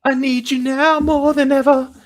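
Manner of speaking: high falsetto plea, hammed up; joking needy falsetto plea; mock pleading falsetto. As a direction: beg in a squeaky put-on voice.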